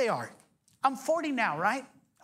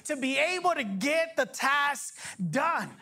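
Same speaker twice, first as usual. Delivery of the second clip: raised, fervent preaching voice; full-force sermon delivery; 9 semitones higher than usual. Acted.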